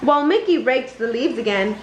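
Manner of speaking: plain reading voice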